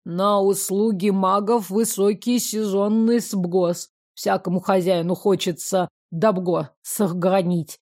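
lawyer with a guttural burred r, every r in «Ягослава Елизаговна Ольбганская» rolled in the throat. A narrator's reading. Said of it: "смешным картавым говором".

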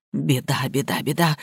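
low, soft despairing mutter; muttered lament. A narrator's reading. Master fearing scandal after a death, muttered lament. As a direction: repeat it under the breath, woeful.

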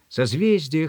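ordinary delivery

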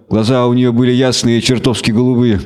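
plain reading voice

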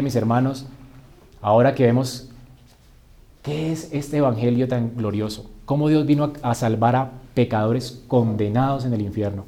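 usual speaking voice

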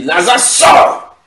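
high, rising commanding shout; booming call to the dead; loud cry. Acted. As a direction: shout the summons, exploding on the last word. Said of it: "il cria d'une voix forte".

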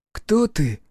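put-on high, wary little boy voice; timid child voice. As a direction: ask like a frightened small child. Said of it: "Негромкий, насторожённый, даже немного испуганный голос".

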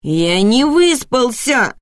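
drawn-out whiny protest; complaining. A narrator's reading. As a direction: drag it out like a sleepy child complaining. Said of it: "пожаловался сын".

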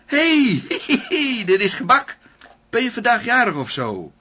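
high, loud excited outburst; sudden excitement. Acted. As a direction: blurt it out with glee. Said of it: "Knijp ineens". (hoge, luide opgewonden uitroep)

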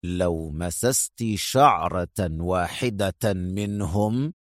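low, soft, very slow threat; cold steely warning; steel-hard. A narrator's reading. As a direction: menace every word. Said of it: "في صوت أقسى من الصلب".

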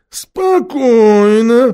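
very drawn-out, high, loud call; steadying repeat. A narrator's reading. Khmur steadying his companions again, long drawn-out call. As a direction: stretch the word long to steady everyone. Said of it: "повторил Хмур".

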